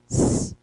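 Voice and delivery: a sustained hissed S; isolated S as a pure hiss; S sound alone. Shown as a demonstration. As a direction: hold the S sound by itself. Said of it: "além de eu pronunciar".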